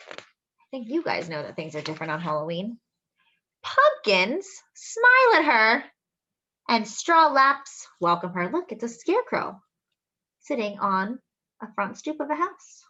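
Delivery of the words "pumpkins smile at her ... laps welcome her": slowing gently as she reads; storybook reading. Acted. Reading to children, slowing and gentle. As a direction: let the line slow down like a cat walking.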